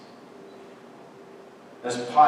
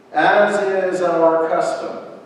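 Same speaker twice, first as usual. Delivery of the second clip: raised, projected public address; loud proclamation; 7 semitones higher, 11 dB louder than usual. A narrator's reading. Pilate offers the crowd a prisoner's release, proclaiming loudly. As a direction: address a whole crowd as the governor.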